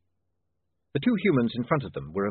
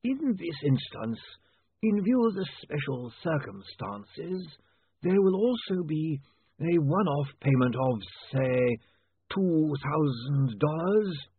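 very slow, high, drawn-out legalese; oily, ponderous lawyer naming a fee; special lawyer's voice; 7 semitones higher, slower than usual. A narrator's reading. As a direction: savour every word as if sucking in money. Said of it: "special lawyer's voice that sucked in money at every pore".

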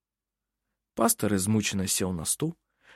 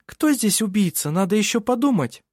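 much higher, louder proud woman's voice; proud young woman; 11 semitones higher, 4 dB louder than usual. A narrator's reading. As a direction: Vinga answers the judge with pride. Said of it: "гордо подняв голову". (Винга гордо отвечает судье)

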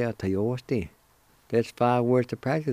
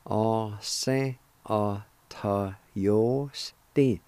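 "a se a ta yo sti" drawn out slowly, syllable by syllable; slow it right down for learners; much slower than usual. Shown as a demonstration. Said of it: "And together".

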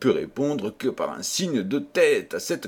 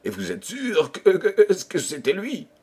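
higher, stuttering anxious question; terrified stammer; 8 semitones higher than usual. A narrator's reading. The king checking whom Renardino saw, stammering with terror. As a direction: stumble and repeat in rising fear. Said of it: "bègue de terreur".

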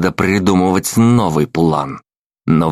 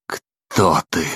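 hoarse. Acted. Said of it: "хрипло выдавил я".

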